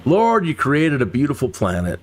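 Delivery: normal talking voice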